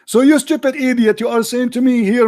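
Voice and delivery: ordinary talk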